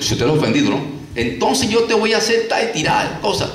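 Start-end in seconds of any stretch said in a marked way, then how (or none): 1.1-3.5 high, hammed-up angry threat; mock angry threat. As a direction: pitch it up like someone about to throw things. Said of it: "golpear, tirando objetos". (amenaza furiosa fingida, aguda)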